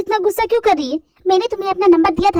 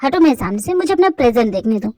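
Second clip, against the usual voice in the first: put-on lower, insistent young man demanding an answer; angry young man; 6 semitones lower than usual. Boyfriend confronting girl about the kiss, lower insistent male voice. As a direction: press her for the truth.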